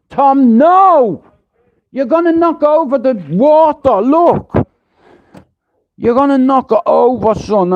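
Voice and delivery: alarmed parent stopping a spill; scolding a child. Retold or imitated. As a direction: shout a quick warning at your son.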